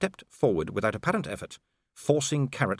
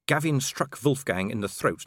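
plain reading voice

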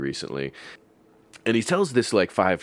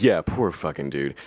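ordinary talk

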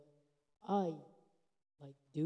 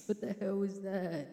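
morphing voice; 5 semitones higher than usual. Real, not acted. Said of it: "voice changer".

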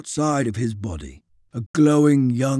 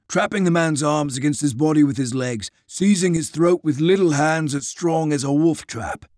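ordinary delivery

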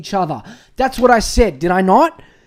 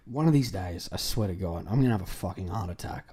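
much lower, softer grumble; low, softer exasperated aside; 12 semitones lower, 8 dB quieter than usual. Real, not acted. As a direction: mutter it under your breath, exhausted.

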